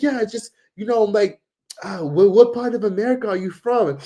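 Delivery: high-pitched imitation of a halting questioner; chatty stranger. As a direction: mimic a local making stumbling small talk.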